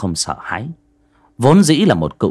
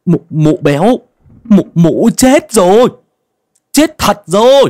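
much higher and louder, stammering in panic; terrified stammer; 11 semitones higher, 7 dB louder than usual. Acted. Young man stammers to the old man about the dead woman, panicked. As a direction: stutter it out, terrified of what he saw. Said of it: "giọng lắp bắp".

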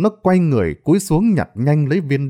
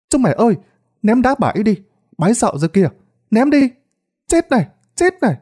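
high, excited rallying cries; rally the gang to attack, pitch climbing high; boys egging each other on; 9 semitones higher, faster than usual. A narrator's reading. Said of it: "thì liền hò hét nhau". (giọng trẻ con hò hét)

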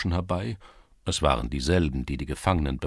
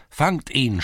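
higher, louder repeated cry; louder shout; 8 semitones higher, 5 dB louder than usual. A narrator's reading. Lads in the lane shouting again, louder. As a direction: yell it again, harder. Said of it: "schrien einige Burschen".

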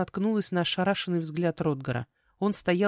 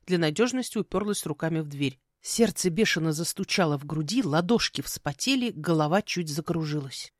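ordinary delivery, nothing marked

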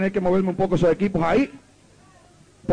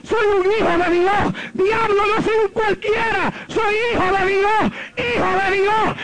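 high shout breaking into tears; reliving the night he cried out to the devil; crying shout; 10 semitones higher than usual. Retold or imitated. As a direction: sob it out while proclaiming. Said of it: "Y le grité".

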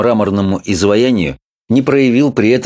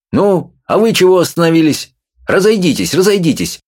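commanding shout; 9 semitones higher than usual. A narrator's reading. Constable shouting at gawking boys, commanding. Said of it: "повелительно крикнул он".

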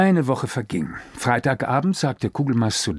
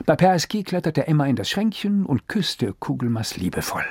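ordinary delivery

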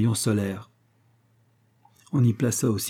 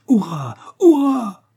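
loud, rising to a much higher shout; a crowd of survivors whooping with joy; joyful cheer; 12 semitones higher, 7 dB louder, slower than usual. A narrator's reading. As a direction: cheer in wild relief. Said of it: "s'écrièrent tous ces infortunés".

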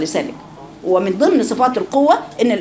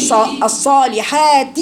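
الصالحات drawn out very slowly; slow reading; 2 semitones higher, much slower than usual. Shown as a demonstration. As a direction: stretch الصالحات syllable by syllable. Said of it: "هاتوها مكسورة".

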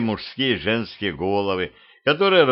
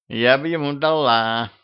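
slightly higher, suggestive purr; lustful; 4 semitones higher than usual. A narrator's reading. Blonde head taunts a wife about her husband, lustful. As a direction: provoke her with open appetite. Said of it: "плотоядно облизнув губы".

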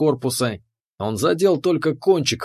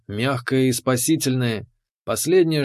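ordinary delivery

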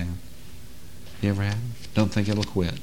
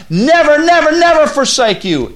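each Never shouted very high; triple never; 10 dB louder than usual. Acted. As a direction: stack the three nevers, higher each time. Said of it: "It's a triple negative".

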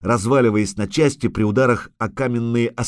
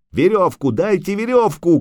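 high, frantic cry for help; raised, insistent yell; shouting; 9 semitones higher, 4 dB louder than usual. A narrator's reading. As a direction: shout the demand urgently. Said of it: "кричал Лютик".

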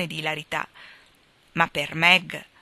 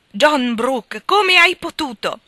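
John Brooke cried out much higher and louder, slow; desperate reproach; 7 semitones higher, 9 dB louder, slower than usual. A narrator's reading. Meg cries out her husband's name in despair. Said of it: "di rimprovero e di disperazione".

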